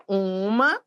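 ordinary talk, nothing marked

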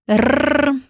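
long trilled Rrr; rolled R. Shown as a demonstration. Teacher leading a warm-up trill, a rolled R. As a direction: roll the tongue, warm-up trill. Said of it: "Repete comigo".

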